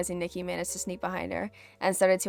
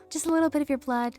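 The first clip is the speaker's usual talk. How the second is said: higher, faster, creepy sing-song Toga; Toga's creepy voice; 7 semitones higher, faster than usual. Retold or imitated. Reader repeating Toga's line, higher and creepy. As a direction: purr it like a bloodthirsty girl. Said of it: "I know that voice".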